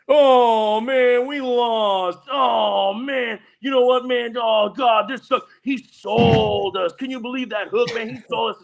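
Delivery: high, loud whining complaint; high-pitched mock moaning, dragged out; mocking a whiny loser. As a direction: whine like a sore bettor who lost. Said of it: "loser's mentality ... and says".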